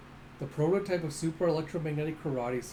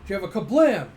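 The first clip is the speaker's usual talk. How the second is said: loud kablam; 4 semitones higher, 10 dB louder than usual. Acted. Recapping the final blow to viewers, a loud kablam. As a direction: burst out with a big kablam.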